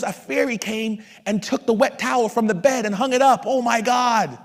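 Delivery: high, loud, hammed-up marvelling; amazed wife. Husband imitating wife finding the towel hung up, mock amazement.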